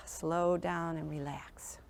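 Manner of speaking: slow, soft whisper; showing the class how to wind down, whispered slowly; soothing whisper. Shown as a demonstration. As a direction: breathe the words out slowly to show calm. Teacher modelling calm bedtime mood.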